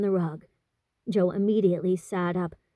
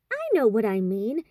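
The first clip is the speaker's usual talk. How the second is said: plain reading voice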